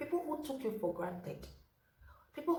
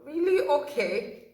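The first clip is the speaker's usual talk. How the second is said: mock surprise; 6 semitones higher, 10 dB louder, slower than usual. Mimicking reply to friend's lie.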